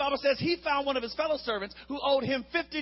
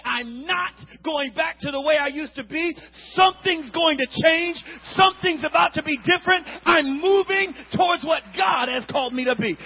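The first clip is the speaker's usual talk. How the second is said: loud, high resolute proclamation; shouted declaration; 6 semitones higher, 8 dB louder than usual. Acted. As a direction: shout it like a vow before God. Congregation declares change, preaching.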